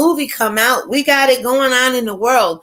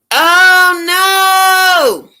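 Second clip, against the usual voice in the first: high, loud cry of dismay; real loud oh no; alarmed shout; 9 semitones higher, 8 dB louder than usual. Real, not acted. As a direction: yelp as the stream drops out. Stream cuts out suddenly.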